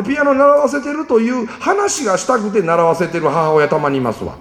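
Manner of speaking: ordinary delivery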